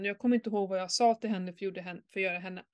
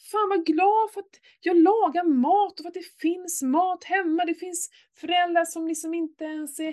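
exasperated rant; 7 semitones higher than usual. Retold or imitated. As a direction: vent the frustration. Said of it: "jag blir bara så här".

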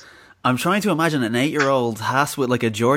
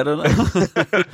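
laughing; 4 semitones higher than usual. Real, not acted. Laughs at own joke.

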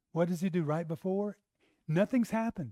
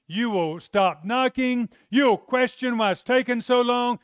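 higher and louder, driving each point home; preaching at full force; 6 semitones higher, 5 dB louder than usual. Acted. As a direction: preach it with rising fervor to the church. Preacher exhorting the congregation.

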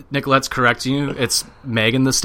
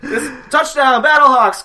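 stadium announcer; 12 semitones higher, 6 dB louder, slower than usual. Acted.

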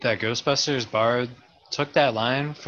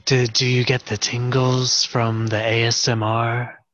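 ASMR question with more volume; slightly louder ASMR; 4 dB louder than usual. Pretend. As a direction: project the hushed question a bit. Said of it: "not too much louder in volume".

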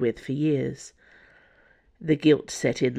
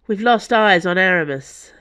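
louder, higher, urgent report; worried; 4 semitones higher, 6 dB louder than usual. A narrator's reading. Porthos reporting a missing teammate to the boss, worried. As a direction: report it with mild worry. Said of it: "sounding worried".

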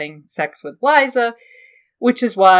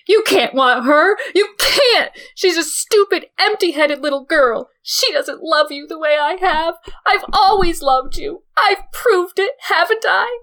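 high, loud, near-tearful jealous pleading; hysterical jealous aunt; 11 semitones higher, 4 dB louder than usual. Acted. Lysa to Littlefinger over Sansa, high, loud, tearful shouting. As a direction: Lysa begging Littlefinger to choose her. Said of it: "Tears ran down her aunt's puffy red face".

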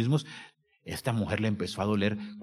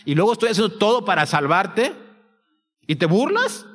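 rising, louder reproach as God's voice; indignant God; 6 semitones higher, 4 dB louder than usual. Acted. God rebukes the sinner for mocking salvation, indignant. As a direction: build to an incredulous, high-pitched challenge. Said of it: "porque Él está diciendo".

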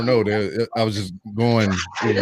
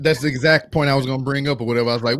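ordinary talk

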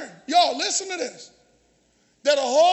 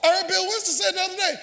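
ordinary talk, nothing marked

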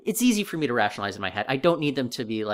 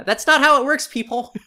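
exasperated outburst; 11 semitones higher, 10 dB louder than usual. Real, not acted. Exasperated rebuttal of show logic.

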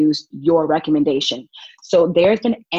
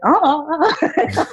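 nervous uh-uh; 10 semitones higher than usual. Retold or imitated. Nervous refusal in court, retold.

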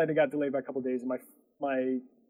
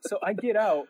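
ordinary talk, nothing marked

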